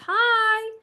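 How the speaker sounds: high called hi; cheery high greeting back; waving hi back. Pretend. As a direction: call hi back across the park.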